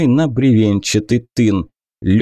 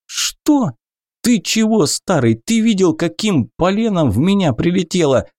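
high, incredulous protest; indignant disbelief; 7 semitones higher than usual. Acted. Wounded hero protests that his arrow wound is called a scratch, high indignant disbelief. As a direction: squeal in disbelief at the old man. Outraged at being told it's a scratch.

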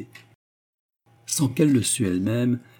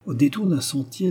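usual speaking voice

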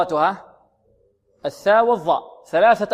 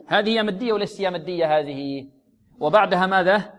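ordinary delivery

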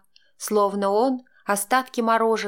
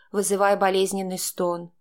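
plain reading voice

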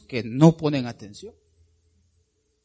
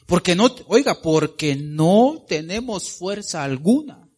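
slow, raised pleading reading; slow, solemn reading of a desperate prayer; scripture prayer; 4 semitones higher, 7 dB louder, slower than usual. Acted. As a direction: read the king's plea slowly to God. Reads King Jehoshaphat's prayer.

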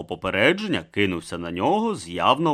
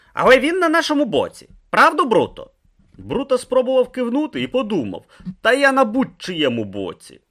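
higher, louder voiced dialogue; character voices; 12 semitones higher, 5 dB louder than usual. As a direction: play each figure lifted above the narration. Narrator voices Urn, thug, Brutha.

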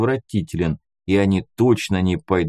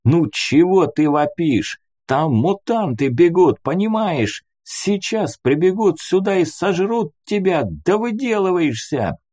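raised pitch, urgent telling-off; exasperated scolding; 8 semitones higher than usual. A narrator's reading. Hero shakes the boy and warns of mutants, scolding urgently. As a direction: scold a child while warning of danger.

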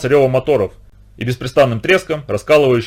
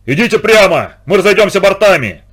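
loud, raised call over engines; booming shout; 6 semitones higher, 5 dB louder than usual. A narrator's reading. Higgins to the yacht through engine roar, shouting. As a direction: bellow instructions across the water. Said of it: "донёсся его зычный голос".

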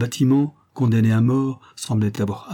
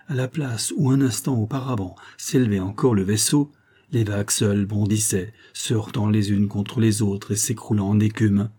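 ordinary delivery

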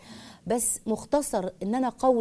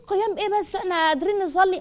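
much higher, scoffing mock voice; dismissive mock quote; 9 semitones higher than usual. Host mocking people who shrug off night prayer, high scoffing. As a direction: scoff at it like a lazy excuse-maker. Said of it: "يقول لك".

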